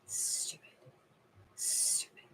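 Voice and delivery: hushed, scornful whisper; whispered grumble. Real, not acted. Grumbling to herself about wind chill, whispered. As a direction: breathe it out under your breath.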